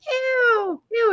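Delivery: high squeal of disgust; mock toddler's drawn-out disgust; imitating little girl. Mom mimics daughter as plane noises restart, squealing. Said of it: "And she's like".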